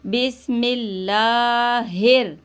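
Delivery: बिस्मिल्लाहिर with a light, thin laam, chanted slowly; thin Allah. Shown as a demonstration. Teacher showing the thin Allah in बिस्मिल्लाहिर. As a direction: recite बिस्मिल्लाहिर slowly with a fine l. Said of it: "अल्लाह के नाम को हमें बारीक पढ़ना है".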